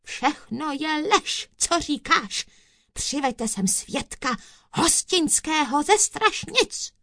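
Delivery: high-pitched indignant dismissal; blustering judge. A narrator's reading. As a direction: dismiss it and call out the order. Judge rejects story, orders witness in.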